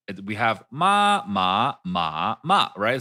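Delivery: "ma" dicho en los cuatro tonos del mandarín, alto, subiendo, bajando y cayendo; four Mandarin tones. Shown as a demonstration. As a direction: say "ma" four times, each in a different Mandarin tone. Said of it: "they're all four different things".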